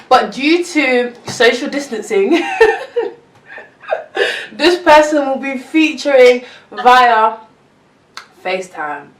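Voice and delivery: giggling through words; laughing. Real, not acted. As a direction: talk while laughing.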